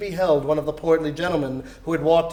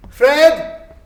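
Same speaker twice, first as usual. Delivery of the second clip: much higher, loud call; 9 semitones higher, 9 dB louder than usual. Acted. Scrooge calling his nephew at the door, loud and high. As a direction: call his name through the house.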